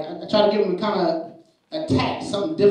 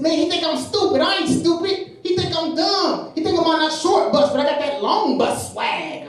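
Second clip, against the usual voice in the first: high-pitched indignant schoolboy; mad kid; 12 semitones higher than usual. Retold or imitated. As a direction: imitate a worked-up kid defending himself. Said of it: "he just looks mad".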